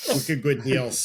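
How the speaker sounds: laughing mock Boston accent. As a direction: toss off wicked good deals Boston style. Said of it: "in a Boston accent".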